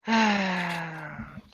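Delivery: long exasperated sigh; loud weary sigh. Real, not acted. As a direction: heave a tired sigh. GM sighing before answering.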